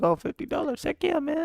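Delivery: high, thrilled voice; mock excitement. Player recalling seeing the fifty dollar donation, high excited voice. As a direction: squeal with delight at the donation. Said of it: "I was like".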